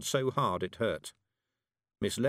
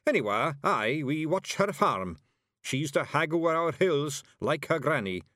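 high, measured Scots boast; proud; 7 semitones higher, 4 dB louder than usual. A narrator's reading. Warrior praises the young hag to witch, proud. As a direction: speak up proudly for her. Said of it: "he added proudly".

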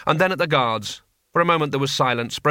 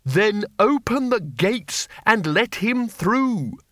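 high, slow commanding call; drawn-out, raised-pitch proclamation; shouted; 8 semitones higher, slower than usual. A narrator's reading. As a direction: call out an order to the guards. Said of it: "and shouted".

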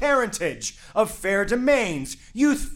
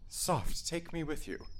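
unvoiced, much softer aside; hushed; 3 semitones lower, 13 dB quieter than usual. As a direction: hush it to yourself before asking. Father hushes, asks wife to explain.

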